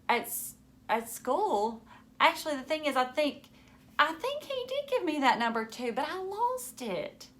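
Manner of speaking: mild nervous fumbling; flustered stammer. Acted. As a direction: stall for an answer. Caught out on the phone.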